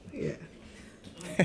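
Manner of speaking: soft breathy nervous chuckle; nervous laugh. Real, not acted. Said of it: "Don't do that little nervous laugh".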